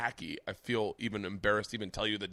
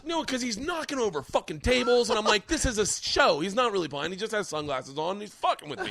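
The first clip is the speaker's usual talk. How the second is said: excited storytelling; 12 semitones higher, 8 dB louder than usual. Real, not acted. Telling climax in raised voice.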